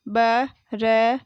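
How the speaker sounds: बरसाया broken into ba, ra, saa, yaa; बरसाया letter by letter with gaps between; spelled out. Shown as a demonstration. Teacher sounding out बरसाया for pupils, syllable by syllable. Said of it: "मैंने इस तरीके से पढ़ा".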